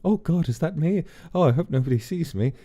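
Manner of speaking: high-pitched, fretful little aside; Sophie. Retold or imitated. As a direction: act out a mildly dismayed old lady. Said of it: "She's like".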